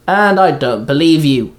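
ordinary delivery

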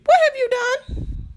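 high, slow, shocked squeal; shocked shriek. Acted. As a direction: gasp it out in disbelief. Question repeated, shrieked high.

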